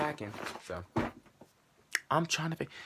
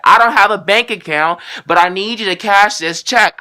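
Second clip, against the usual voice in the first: mocking scammer; 8 semitones higher, 15 dB louder than usual. Quoting the scammer's line.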